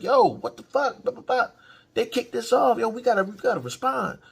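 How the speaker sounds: high, loud imitated outburst; mock angry yelling. Daemon yells at Rhaenyra in the council, high and loud. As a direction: raise your voice at her like Daemon would. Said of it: "start raising his voice".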